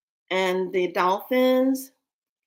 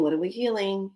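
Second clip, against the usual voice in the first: ordinary talk, nothing marked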